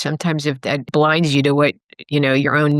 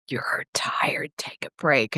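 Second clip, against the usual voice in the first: inner voice whisper; 2 semitones lower, 8 dB quieter, slower than usual. Retold or imitated. The inner voice urging her to rest, whispered. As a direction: breathe it like a kind inner voice. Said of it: "the whisper".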